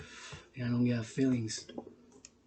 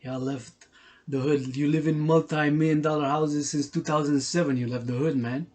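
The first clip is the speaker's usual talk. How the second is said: normal talking voice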